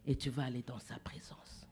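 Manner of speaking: hushed, breathy preacher's whisper. Acted. Preacher whispers a promise to the congregation.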